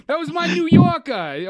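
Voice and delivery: loud, high, drawn-out New York accent; New Yorker accent. Shown as a demonstration. Podcaster defends his botched accent to co-host, New Yorker accent. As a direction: lean hard into a rough stab at New York. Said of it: "like, a Brooklyn accent".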